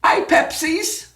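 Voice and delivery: high asking Hay Pepsis; asking at a stand. Retold or imitated. Tourist asking a Mexican vendor for Pepsi, high. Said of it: "so I would go up and say".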